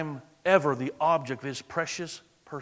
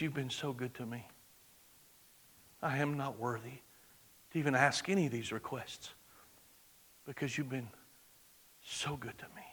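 humble whispered prayer; 4 semitones lower, 13 dB quieter than usual. Retold or imitated. David's humble prayer quoted.

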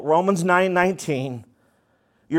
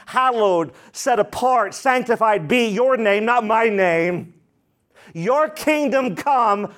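fervent prayer; 5 semitones higher than usual. Acted. Prayerful preaching.

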